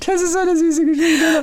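high, through laughter; laughing. Real, not acted. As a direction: speak through tears of laughter. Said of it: "still laughing after story". (lachend gesprochen)

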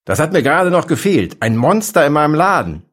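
shrill, loud, high-pitched outrage. A narrator's reading. Shopkeeper outraged at a monster in his shop, shrill. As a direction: let the voice climb into shrill indignation. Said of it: "Die Stimme des Mannes wurde schrill".

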